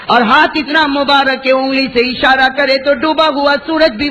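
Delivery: high, ringing sermon delivery, slowed for effect; full-force preaching. Acted. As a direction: proclaim it to the crowd with rising fervour. (پورے جوش سے اونچی آواز میں وعظ)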